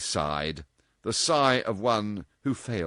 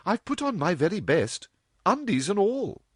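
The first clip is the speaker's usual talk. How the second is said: put-on a higher, playful young woman; lightly voiced cheeky girl; 11 semitones higher, 4 dB louder than usual. A narrator's reading. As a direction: tease her mother lightly. Dorothy voiced in story.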